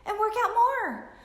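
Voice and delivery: high, mocking doctor advice voice; mimicking the doctors' advice in a high voice. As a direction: parrot the doctors in a high sing-song. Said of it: "They all said".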